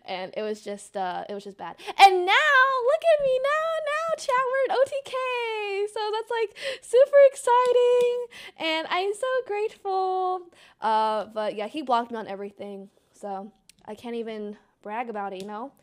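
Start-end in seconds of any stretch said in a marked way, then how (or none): 1.9-10.4 high-pitched, squealy gushing; giddy, high gratitude; gushing excitement. Real, not acted. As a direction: gush to chat in a high, overjoyed voice. Gushing to chat about success.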